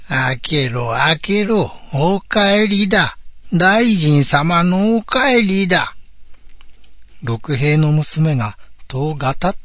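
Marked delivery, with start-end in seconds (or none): 0.1-6.0 odd shouting voice. Acted. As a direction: shout like a self-made lord. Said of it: "おかしな声で外から怒鳴りました".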